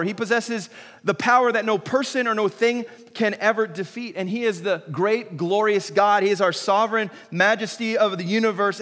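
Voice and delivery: full-force preaching. Acted. Pastor stirring the congregation to praise God, fervent preaching. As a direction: preach it with fire to the room.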